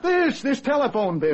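high, exasperated accusation; angry. Acted. Husband accuses his wife over the phone bill, angry. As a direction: stumble into an indignant accusation. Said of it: "Why are you so angry?".

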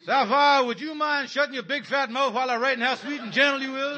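loud, high, fed-up holler at his wife; husband losing patience, yelling back at his nagging wife; exasperated yell. Acted. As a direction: bellow it over the kitchen noise.

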